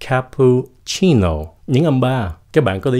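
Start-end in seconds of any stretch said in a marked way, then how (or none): none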